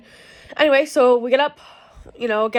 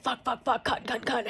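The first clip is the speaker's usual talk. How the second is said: muttered cursing; 8 dB quieter than usual. Retold or imitated. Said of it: "I'm cussing left, right, and center".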